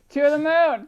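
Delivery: laughing, loud, high hype; rapid hyped trader panic. Pretend. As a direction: shout it mid-laugh. Stock meme hype.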